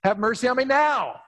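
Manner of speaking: high, ringing plea; calling out again. Acted. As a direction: call out again to be heard. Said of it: "so he lets it out again".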